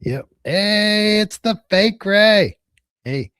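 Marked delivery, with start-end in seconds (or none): 0.4-2.6 high, loud, drawn-out hey; hailing greeting. Real, not acted. Host greets fake Ray, loud drawn-out hail. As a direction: call out a big stretched greeting. Calls out greeting to someone arriving.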